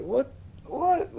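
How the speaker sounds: high, squeaky disbelief; very high mock shock; shocked what. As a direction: gasp out what, what. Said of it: "he was like".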